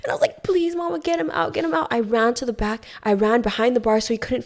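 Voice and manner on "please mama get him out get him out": higher, pleading; waitress pleading with her boss in fear; frightened begging. Retold or imitated. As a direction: beg urgently. Begging boss to remove stalker.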